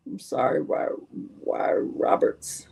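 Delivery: breathy unvoiced aside; hushed question; whispered aside. Real, not acted. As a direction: whisper it off-mic.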